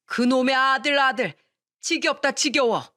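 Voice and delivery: fed up, throw the words out; fed-up outburst. A narrator's reading. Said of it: "지겹다고 화를 내더니".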